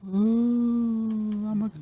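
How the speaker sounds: high, soft spooky ghost moan. Pretend. Playing a ghost.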